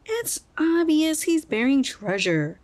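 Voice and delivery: high, slow, eager squirrel voice; excited. A narrator's reading. Curry guesses about the hole, high and excited. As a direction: relish the idea of treasure. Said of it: "Curry said excitedly".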